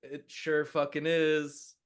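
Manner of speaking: excited. Real, not acted. Excited over a natural twenty.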